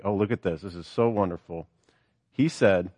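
normal talking voice

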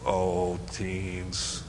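low, soft, drawn-out lament; disappointed. Real, not acted. Preacher let down by teens' wrong answer, disappointed. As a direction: sigh the words out in dismay.

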